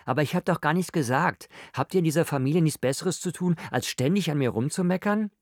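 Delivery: high-pitched, aggrieved protest; indignant complaint. A narrator's reading. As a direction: protest hotly against the family's nagging. Josephine snaps back at family nagging.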